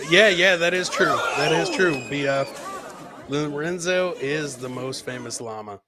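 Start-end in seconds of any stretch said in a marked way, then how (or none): none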